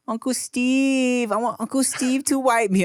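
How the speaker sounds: put-on high, slow pleading child; toddler voice. Daughter asking her uncle for help, high and slow. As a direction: whine it like a little girl. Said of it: "she's like".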